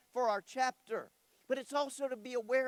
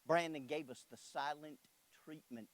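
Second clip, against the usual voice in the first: lower, softer storytelling opener; low and quiet; 7 semitones lower, 6 dB quieter than usual. Real, not acted. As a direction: draw them in quietly. Soft opening line.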